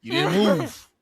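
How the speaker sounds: loud, tough gangster talk; tough baby voice. Pretend. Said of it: "She's like".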